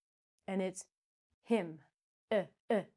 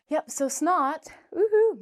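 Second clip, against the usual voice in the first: excited; 8 semitones higher, 10 dB louder than usual. Real, not acted. Excited about favorite word.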